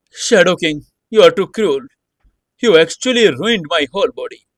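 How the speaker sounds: high, loud furious cry of pain; roaring in pain. Acted. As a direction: roar it out, wounded and angry. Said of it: "He roared".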